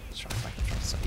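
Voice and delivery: soft unvoiced whisper. Real, not acted. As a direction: whisper the plan to yourself. Repeat whispered.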